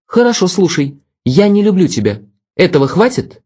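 put-on cold, superior young woman; Vera, cold. A narrator's reading. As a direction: voice her aloof and cutting. Vera's voice, cold.

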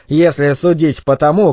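ordinary delivery

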